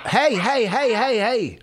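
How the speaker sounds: rapid repeated high call. Real, not acted. Parent calling someone back, high insistent call. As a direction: call after them to get attention.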